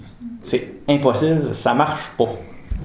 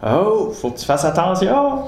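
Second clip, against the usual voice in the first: père inquiet; 7 semitones higher than usual. Retold or imitated. Père met en garde.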